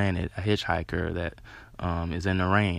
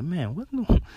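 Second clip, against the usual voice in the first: sudden high, louder mock bafflement; baffled exclamation; 11 semitones higher, 5 dB louder, slower than usual. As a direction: play a confused onlooker who can't work out what's going on. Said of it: "be like".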